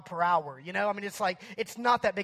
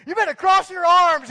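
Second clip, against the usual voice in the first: high, very loud drill-sergeant yell; barked order; 9 semitones higher, 13 dB louder than usual. Retold or imitated. Lifeguard ordering a kid on the slide, yelled. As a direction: yell it like a power-tripping guard. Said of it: "barking out orders like a drill sergeant".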